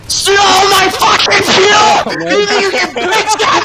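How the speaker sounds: high, drawn-out enraged shouting; real furious screaming. Real, not acted. Player rages at teammate over stolen fuel, furious screaming.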